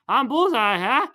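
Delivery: mock boast. Villain boasting in retelling.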